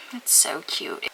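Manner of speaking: hushed whisper of delight. Real, not acted. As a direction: whisper it tenderly. Whispered repeat.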